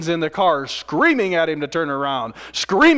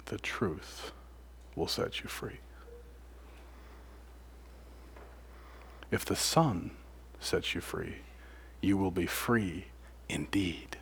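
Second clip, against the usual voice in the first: much softer and lower, ending in a whisper; hushed scripture; 8 semitones lower, 14 dB quieter, slower than usual. Real, not acted. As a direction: breathe the verse out, nearly silent at the end. Preacher quotes scripture softly.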